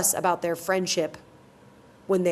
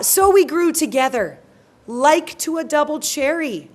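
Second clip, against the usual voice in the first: higher, louder theatrical Shakespeare recitation; declaimed Shakespeare, pitched up; dramatic verse reading; 5 semitones higher, 4 dB louder than usual. Acted. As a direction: read the verse out in raised, measured phrases. Reads Helena's speech aloud.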